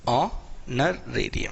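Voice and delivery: ऑनरेरियम drawn out very slowly, no h; slow correct pronunciation. Shown as a demonstration. Teacher repeating the word slowly for students. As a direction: stretch each syllable of ऑनरेरियम, silent h. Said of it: "actual pronunciation".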